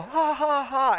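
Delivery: high, hammed-up ha ha ha; mock laugh. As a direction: fake a big laugh. Laughing along at the film.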